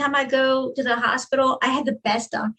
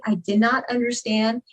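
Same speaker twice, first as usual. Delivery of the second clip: ordinary talk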